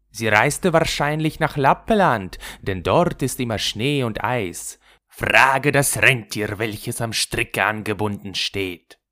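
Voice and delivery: pigeon voice, drawing out „Frage das Rentier“; wood pigeons. Acted. Waldtauben antworten Gerda. (Waldtaubenstimme, lauter und gedehnt)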